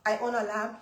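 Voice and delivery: shrinking, softly answering the pastor; little voice. Retold or imitated. Answering the pastor in front of church, small timid voice. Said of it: "in my little voice".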